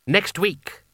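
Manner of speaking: high, firm command; insistent repetition. A narrator's reading. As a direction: repeat it allowing no argument. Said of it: "Coin repeated".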